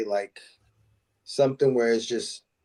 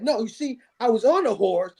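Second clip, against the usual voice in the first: high-pitched cowboy villain telling his grievance; hammed-up Southern outlaw impression; imitating Western outlaw; 9 semitones higher than usual. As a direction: argue like an Old West bully. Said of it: "He's like".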